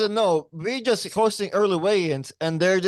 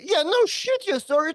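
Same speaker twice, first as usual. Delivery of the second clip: sarcastic retort; 10 semitones higher than usual. Retold or imitated. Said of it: "Hey, guys, I'm sorry".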